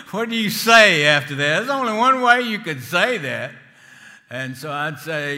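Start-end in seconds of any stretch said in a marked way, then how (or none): 0.0-3.5 louder, higher, laughing through the words. Real, not acted. Preacher laughing at the director's question, loud and amused.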